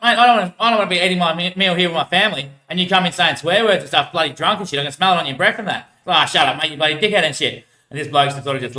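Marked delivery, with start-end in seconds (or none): 0.0-5.9 retold heated protest, pitched up and louder; indignant complaint. Diner scolds drunk swearer at family meal, indignant retelling. Acts out own indignant reply. 6.1-7.7 mock irritated retort, a bit louder; angry comeback. Drunk man swears back at complainer, mildly angry imitation.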